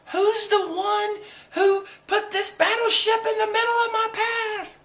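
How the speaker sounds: very high-pitched haughty voice; hammed-up high indignation; mock outraged demand. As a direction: imitate an imperious character furious at being blocked. Acted haughty outburst of Boa Hancock.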